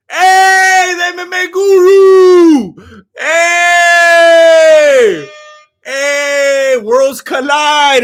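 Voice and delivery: high, loud drawn-out hail; excited shout. Real, not acted. Streamer greeting M M A guru in chat, excited shouting. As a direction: holler it long like a ring announcer. Hailing a guest arriving in chat.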